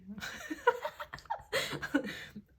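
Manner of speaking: high, drawn-out burst of giggles; giggling fit. Real, not acted. Laughing at own bad accent, giggling fit. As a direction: crack up at yourself. Cracks up at own pronunciation attempt.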